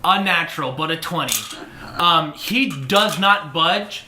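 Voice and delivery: usual speaking voice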